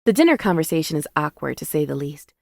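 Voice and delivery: ordinary delivery, nothing marked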